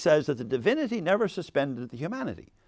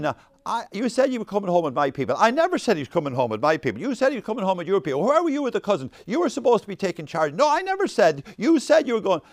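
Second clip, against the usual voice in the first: louder, rising couple's quarrel; mock bickering; 5 semitones higher, 6 dB louder than usual. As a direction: act out parents blaming each other, building higher and louder. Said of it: "bickering at each other about it".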